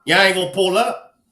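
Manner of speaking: ordinary talk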